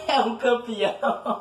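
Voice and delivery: slow, laughing mock praise; laughing mockery. Real, not acted. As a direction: drawl it slowly while laughing. Mocking an enemy player.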